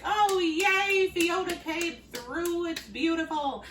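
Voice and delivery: high, loud gushing cheer, slowing on "Fiona came through"; mock cheer. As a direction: play the crowd gushing with delight. Everyone cheering the star.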